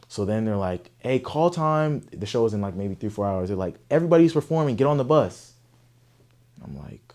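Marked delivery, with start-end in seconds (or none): 1.0-2.0 high, slow call to the group. Retold or imitated. Organizer calling performers. 3.9-5.5 high, loud call to everyone; shouted order. Retold or imitated.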